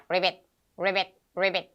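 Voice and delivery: short, low ribbits; frog croak imitation. Teacher showing a frog to kids, frog imitation. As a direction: croak each one like a little frog.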